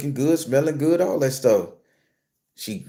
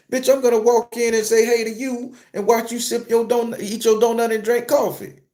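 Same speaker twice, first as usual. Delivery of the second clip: heated rant; 9 semitones higher than usual. Real, not acted. Venting anger at the manager.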